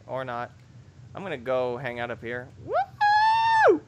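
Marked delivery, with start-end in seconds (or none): 2.6-3.8 very high, loud held whoop. Real, not acted. Gamer launched in a stunt, high whoop. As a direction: whoop in a piercing falsetto.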